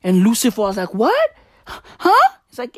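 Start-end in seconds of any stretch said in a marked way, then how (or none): 0.9-2.3 high startled yelps; shocked. Acted. Lucifer hearing Jesus must destroy hell, high startled yelps. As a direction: jolt up in disbelief.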